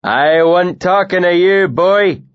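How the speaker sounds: angry northern bully cutting in; growled rebuke. Acted. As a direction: snap him back into his place. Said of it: "he growled".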